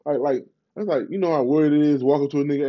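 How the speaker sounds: ordinary talk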